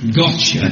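Gotcha said as got-cha, a quick southern guess; read out as gotcha. Shown as a demonstration. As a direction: sound out Gotcha like a Londoner would. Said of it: "pronounce it for me".

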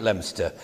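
Leominster said as LEM-ster; two syllables for Leominster, "Lemster"; local pronunciation. Retold or imitated. Wondering about the spelling, Leominster pronounced LEM-ster. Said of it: "why don't they write it".

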